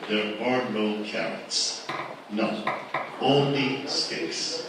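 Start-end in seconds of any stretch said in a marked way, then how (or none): 3.2-4.6 ONLY drawn out very slowly and weighted; slow emphatic. Real, not acted. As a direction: lean hard on "Only", then let "sticks" land. Hammering home a policy point.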